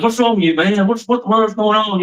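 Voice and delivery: high-pitched retold bewilderment; bewildered. Rehab men to a crying newcomer, bewildered. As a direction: imitate baffled onlookers demanding an explanation.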